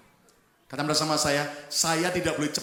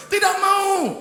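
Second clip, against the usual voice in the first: high loud furious no; angry refusal; 11 semitones higher, 8 dB louder, slower than usual. Acted. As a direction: refuse him angrily. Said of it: "wanita ini marah".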